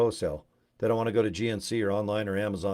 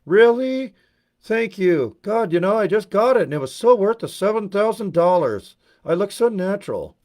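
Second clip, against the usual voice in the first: much higher, louder, gushing put-on voice; gush, delighted and vain; Botox lady; 10 semitones higher, 8 dB louder than usual. A Utah woman thanking a friend for the compliment, high and gushing. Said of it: "they look like this".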